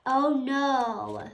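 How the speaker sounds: ordinary delivery, nothing marked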